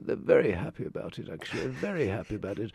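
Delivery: very posh English gentleman voice; posh, measured mock-upper-class delivery; George Martin. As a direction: imitate a refined, understated record producer. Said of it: "in his very posh voice".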